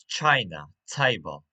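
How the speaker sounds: "China" and "table" each hit loud on the first syllable; "China", "table": strong first syllable, falling second; stress on first syllable. Shown as a demonstration. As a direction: punch the start of "China" and "table". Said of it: "stress on first syllable".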